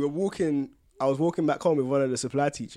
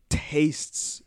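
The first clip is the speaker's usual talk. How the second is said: whispered word; 2 semitones lower than usual. Real, not acted. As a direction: whisper it with distaste. Whispered distaste.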